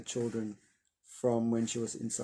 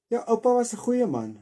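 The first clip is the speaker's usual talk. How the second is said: grandma's line raised higher and louder, almost like a eulogy; 11 semitones higher, 7 dB louder than usual. Acted. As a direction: speak as the grandmother at a funeral. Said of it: "sê my ouma".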